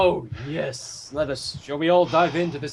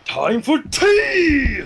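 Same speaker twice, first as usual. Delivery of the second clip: sing-song tea call; 9 semitones higher, 4 dB louder, much slower than usual. Acted. Mimics Gonzo getting tea.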